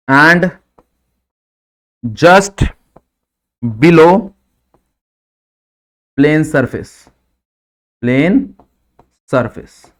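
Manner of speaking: isolated words with long pauses; word-by-word dictation. Real, not acted. Teacher gives students a key line to note, dictation pace. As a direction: dictate slowly, one word at a time.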